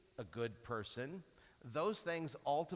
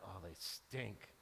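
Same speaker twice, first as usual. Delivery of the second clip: deflated; 8 semitones lower, 11 dB quieter than usual. Retold or imitated. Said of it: "back down to".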